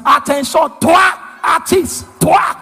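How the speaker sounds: shouted parade-ground orders, drawn out; drill command. Acted. Comic playing a policeman standing to attention, shouted command.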